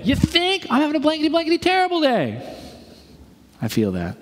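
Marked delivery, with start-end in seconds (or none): 0.0-2.6 much higher, mock-exasperated outburst; sarcastic angry retort. Angry passenger answering the pastor, hammed-up exasperation. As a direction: snap back at the nosy stranger.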